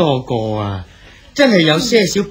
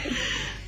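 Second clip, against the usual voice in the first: soft chuckle; 15 dB quieter than usual. Acted. Poet chuckles at the girl's praise.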